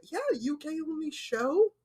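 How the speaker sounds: ordinary delivery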